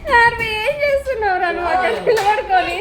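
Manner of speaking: calling out. Real, not acted. Parent asking a child standing over there, calling out.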